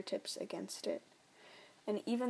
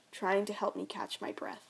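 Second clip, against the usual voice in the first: usual speaking voice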